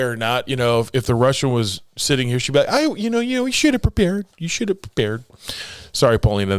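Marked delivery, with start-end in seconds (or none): 2.7-4.3 much higher, fast nagging mom voice; high-pitched mock mom lecturing; impersonating mom. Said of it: "that was the impersonation of my mom". 4.4-5.2 quieter mom voice, pitch dropping back; softer tail of the mom impression; impersonating mom. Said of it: "that was the impersonation of my mom".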